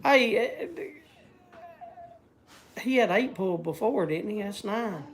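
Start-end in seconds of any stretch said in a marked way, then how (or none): none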